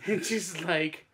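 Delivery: ordinary delivery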